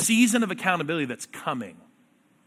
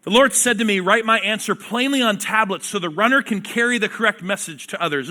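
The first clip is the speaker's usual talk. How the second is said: emphatic Bible reading from the pulpit; scripture reading; 3 semitones higher, 4 dB louder than usual. Acted. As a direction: read the prophet's words with weight. Preacher reads scripture aloud.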